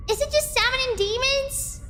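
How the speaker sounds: high-pitched incredulous question; squeaky, astonished questioning; shocked disbelief. Real, not acted. Repeats claim in disbelief.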